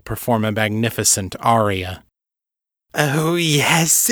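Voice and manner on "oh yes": sing-song, gleeful vampire voice; caroling vampire. Acted. Vampire Fisher gloating over Seffi's pain, caroling. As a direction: croon it with cruel delight. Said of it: "he caroled".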